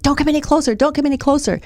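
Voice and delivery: imitating an angry homeless man yelling; retold yell. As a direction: bark him back like a scared angry man. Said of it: "he starts yelling at me".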